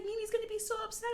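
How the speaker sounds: high-pitched fretting, retold; freaking out. Mom warning daughter about Grandma, high retold panic. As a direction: imitate a panicked parent, voice shooting up. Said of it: "I started freaking out".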